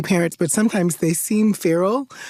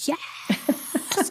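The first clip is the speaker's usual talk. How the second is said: hushed, high mock roar; high, held-back toddler roar; imitated roaring child; 10 semitones higher, 7 dB quieter than usual. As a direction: fake a kid's roar without full voice. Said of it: "they're, like, roaring at me".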